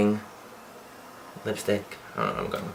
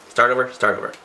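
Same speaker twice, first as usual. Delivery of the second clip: usual speaking voice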